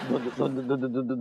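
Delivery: low stammer stuck on the first syllable; stuttering. Acted. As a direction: trip repeatedly over the start of the word. Stammers over the word blurry.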